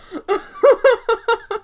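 high-pitched burst of laughing; real, sustained giggling fit; helpless laughter. Real, not acted. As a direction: crack up at your own joke. Cracking up at own mock quote.

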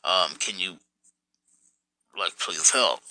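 low, soft, fast plea for help; retelling a quiet request to a buddy. Retold or imitated. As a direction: mumble the message like you're worn out. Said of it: "was messaging my buddy".

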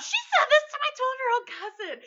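high, loud, scandalized cry; scandalized outburst. Real, not acted. Storyteller reporting Nana's remark to a child, scandalized outburst. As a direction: exclaim it in comic disbelief.

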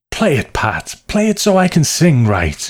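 put-on high, insistent drunken pleading; worked-up, petulant character voice; pleading demand. A narrator's reading. Drunk man demanding piano accompaniment.

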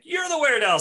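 very high, loud accusing cry; hammed-up shrill counter-accusation; Dean accusing back. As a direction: fling it straight back at him. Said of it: "says Dean".

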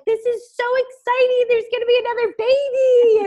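high, loud gushing mom; super excited. Retold or imitated. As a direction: imitate a thrilled grandma-to-be. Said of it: "my mom was super excited".

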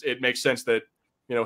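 ordinary talk

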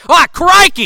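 loud, high mock Australian cry; Steve Irwin. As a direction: exclaim in a hammed-up Australian accent. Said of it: "I'm losing the accent already".

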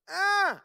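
sharp high imitated baby shriek; baby squeal. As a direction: squeal like a toddler with messy hands. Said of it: "And she went".

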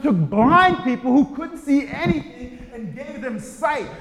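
loud, rising proclamation; preaching at full force. Acted. Preacher to congregation, loud and rising. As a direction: build it like a revival call.

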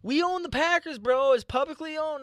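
high excited boasting. Real, not acted. Excited boast, pitch far up.

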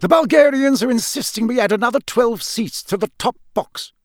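high, loud, rushed complaint; impatient Crouch. A narrator's reading. Official who has searched everywhere for colleague, impatient. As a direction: scold him with impatient urgency. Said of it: "a bite of impatience in his voice".

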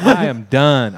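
loud, emphatic retold declaration; retold outburst. Angler retelling his reaction to the lightning, retold outburst. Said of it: "I'm like".

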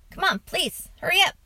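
high-pitched pleading hurry-up; impatient urging. A narrator's reading. Father coaxing boy out of the covers, impatient.

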